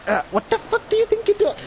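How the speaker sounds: very high, squeaky character voice; high mock voice. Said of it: "He's just like".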